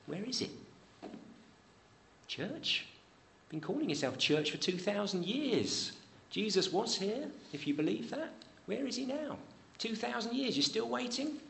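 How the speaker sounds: high, taunting sneer at the church; mocking scoffer. Preacher voicing scoffers taunting the church about Christ's return, high mocking jeer. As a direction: jeer at the believers as a scoffer would. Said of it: "scoffers will come ... and saying".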